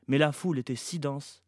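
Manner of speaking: plain reading voice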